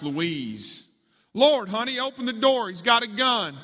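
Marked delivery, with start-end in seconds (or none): 1.3-3.6 high, loud cry for help; raised, frightened shout in a told story; panicked yell. A narrator's reading. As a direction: yell urgently to your wife. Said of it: "Nathan yelled to his wife".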